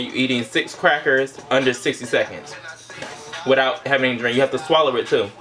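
normal talking voice

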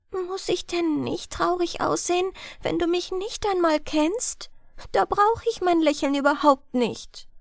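put-on lightly sad girl explaining. A narrator's reading. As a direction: speak small and hushed like the girl. Girl's voice in story.

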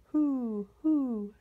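high, drawn-out hooting; owl hoot. A narrator's reading. Fat old owl to the rabbit, hooting. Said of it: "said a fat old owl".

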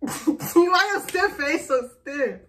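high, loud, slow through laughter; laughing tease. Real, not acted. Wife teasing partner's stiff face, laughing. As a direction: laugh while teasing, drawing it out.

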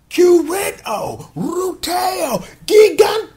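high, drawn-out hero call; mock Zord roll call. Recapper announcing the three Zords, hammed-up roll call. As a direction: call each name out like an anime attack.

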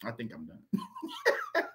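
high, slow, laughing it out; giggling real laughter over the words. Real, not acted. Host cracking up while saying he is finished, laughing.

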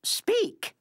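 high sharp command. Acted. Judge commands dog to speak.

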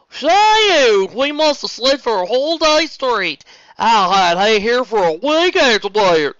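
high bright country voice; loud, twangy farm girl; high, loud country drawl. Acted. Applejack character voice.

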